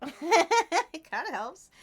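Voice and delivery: chuckling through the words; laughing. Real, not acted. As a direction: laugh while saying it. Laughing at the purple light.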